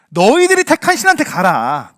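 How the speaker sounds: loud, drawn-out angry command; angry God. Acted. Preacher voices God rebuking Israel, angry. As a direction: send them away in a loud, slow rebuke. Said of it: "많이 화가 나셨을까요".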